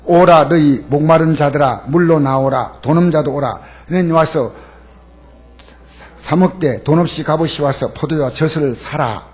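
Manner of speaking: loud, measured proclamation; a preacher declaring the verse; scripture reading. Acted.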